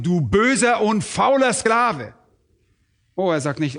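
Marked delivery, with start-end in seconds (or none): 0.0-2.1 slow, loud stern verdict; stern rebuke. Acted. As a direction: pronounce the rebuke heavily and slowly. Herr tadelt Sklaven. (strenger, langsamer Tadel)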